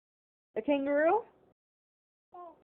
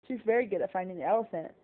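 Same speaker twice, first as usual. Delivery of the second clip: usual speaking voice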